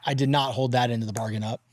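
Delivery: usual speaking voice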